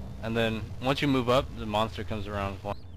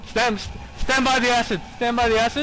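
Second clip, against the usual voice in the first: urgent shout; 12 semitones higher, 6 dB louder than usual. Real, not acted. Urgent instruction in panic.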